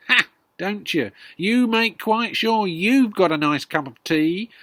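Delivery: put-on loud, high, sneering old woman; accusing grandma. Grandma accusing George of selfishness, sneering loudly. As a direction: jeer at the boy.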